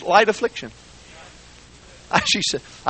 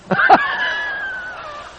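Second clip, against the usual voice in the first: big high laugh. Real, not acted. Preacher laughs at her answer.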